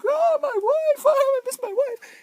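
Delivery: hammed-up high-pitched sobbing husband; mock crying. Retelling the husband missing his wife, mock crying. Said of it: "he s-, starts crying".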